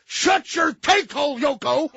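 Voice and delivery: slow, high, loud put-down; loud insult. Retold or imitated. Husband tells nagging wife to shut up, slow loud yell. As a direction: spit out the insult with relish.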